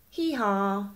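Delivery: ordinary delivery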